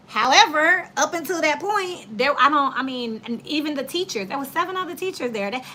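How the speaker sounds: ordinary talk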